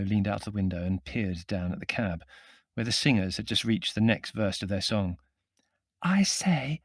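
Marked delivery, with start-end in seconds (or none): 6.0-6.8 high, hushed woman's call; muted calling. A narrator's reading. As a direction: call down while trying to stay hushed. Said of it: "she called in muted tones".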